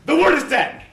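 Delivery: loud, high triumphant cry; triumphant shout. Acted. Lösung laut herausgerufen.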